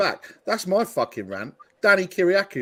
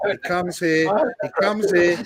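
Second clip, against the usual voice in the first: mock emotional; slower than usual. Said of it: "We heard that crack".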